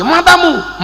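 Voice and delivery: high, sudden mock insult; acted cursing. Someone insulting us in the preacher's example, mock cursing. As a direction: hurl it as a curse. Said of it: "kita dimaki oleh orang".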